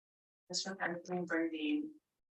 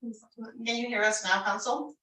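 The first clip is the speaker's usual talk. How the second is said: slow, raised voice to be heard; calling out loud; 5 semitones higher, 4 dB louder, slower than usual. Real, not acted. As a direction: speak up slowly over a bad line. Calling over a bad audio line.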